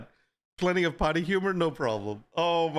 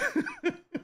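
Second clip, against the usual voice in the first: laughing fit; 3 dB quieter than usual. Real, not acted.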